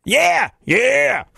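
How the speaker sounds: high shouted metal yeah. Retold or imitated. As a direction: bark it like a metal frontman.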